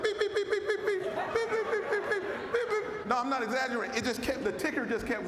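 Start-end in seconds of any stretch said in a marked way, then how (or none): 0.0-3.1 high-pitched mock beeping, laughing through it; rapid high beep-beep imitation of warning flags going off; imitated alarm beeps. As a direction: mimic a ticker alarm that won't stop, chuckling. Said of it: "This organization was like".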